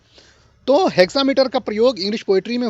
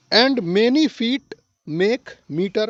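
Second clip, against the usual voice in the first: slow dictation; much slower than usual. Shown as a demonstration. Teacher summing up slowly.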